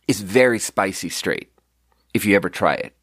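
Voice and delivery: usual speaking voice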